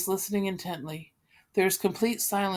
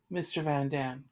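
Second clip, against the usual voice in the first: ordinary delivery, nothing marked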